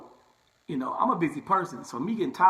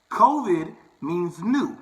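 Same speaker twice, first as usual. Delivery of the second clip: covid means new, each word slowed and louder, NEW landing hard; slow emphatic stress; 4 semitones higher, 6 dB louder, much slower than usual. Shown as a demonstration. Barber explaining to viewers, slow, emphatic stress. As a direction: lean on it like a teacher making it stick. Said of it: "teaching, stressing what covid means".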